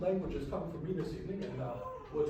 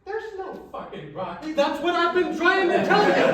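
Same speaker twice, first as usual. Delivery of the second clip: high, building to a loud shout; exasperated outburst; 11 semitones higher, 11 dB louder than usual. Acted. Punchline to the broccoli argument, high exasperated yell. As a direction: burst out with acted exasperation.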